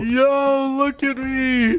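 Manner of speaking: high-pitched, thrilled show-off; hyped squeal; excited. Real, not acted. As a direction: crow it high and delighted. Showing off the new outfit.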